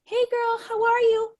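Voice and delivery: high, bubbly imitation of Susie; Susie's sweet greeting. Susie messaging out of the blue, high cheerful voice. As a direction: pipe it up like a cheery friend checking in. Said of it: "she just popped into my inbox and said".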